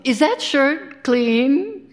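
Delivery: high, drawn-out question; acted domestic query. Acted quote of what husbands say.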